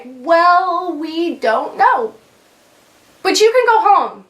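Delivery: high, slow, sing-song mock of clueless doctors. Doctors quoted again, mocked.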